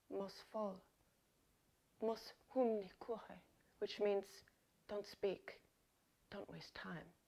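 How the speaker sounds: hushed, soft seduction murmur; intimate murmur. Pretend. Host playing a lover in an intimate Albanian moment, hushed and soft. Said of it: "intimate situation with an Albanian person".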